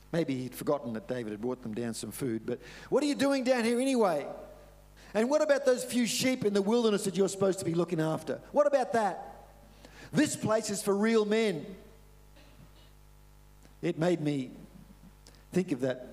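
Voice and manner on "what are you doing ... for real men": higher, louder contemptuous scolding; angry older brother. Retold or imitated. Older brother mocking David for leaving the sheep, angry. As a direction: put the kid brother in his place. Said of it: "And he got angry".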